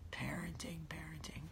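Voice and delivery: PARENTING hushed, dropping into a whisper; very soft PARENTING, then whispered; fading to whisper. Real, not acted. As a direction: let PARENTING shrink away to nothing. Same word repeated, fading to whisper.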